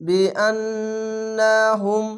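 বিআন্নাহুম with the ন্ন held in a long nasal hum; nasal ghunna. Shown as a demonstration. Teacher showing obligatory ghunna to students, slow. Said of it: "এখানে ওয়াজিব গুন্না".

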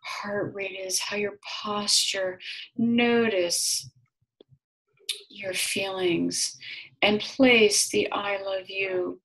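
slow, soft guiding voice; guided meditation. Acted. Healer guiding client through a heart exercise, soft and slow. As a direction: lead her gently into the feeling.

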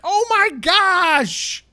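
very high, slow, loud drawn-out gasp of shock. Retold or imitated. Old gamer cursed at by kids, drawn-out high shock. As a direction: stretch it out in horror. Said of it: "you're just like".

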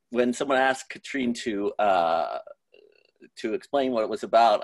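ordinary delivery

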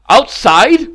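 loud, high-pitched shocked question; startled cry, rising sharply at the end; astonished. A narrator's reading. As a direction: burst out in disbelief. Count shocked by forbidden news.

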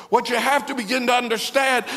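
ordinary talk

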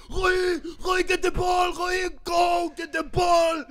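high yelling dad at a game; shouting dad. Retold or imitated. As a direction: yell up high across the court.

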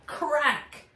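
usual speaking voice

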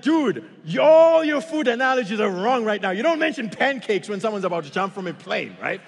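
loud, high comic exasperation; mock-indignant rant; exasperated outburst. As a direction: scold the instructor for his silly comparisons. Acted exasperated outburst at instructor.

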